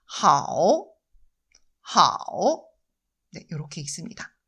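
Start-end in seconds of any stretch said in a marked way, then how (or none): 0.1-2.7 하오 with a dipping third tone; 하오 falling then rising, V-shaped; Mandarin tone. Shown as a demonstration. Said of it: "중국어 발음으로는". (성조를 살린 하오)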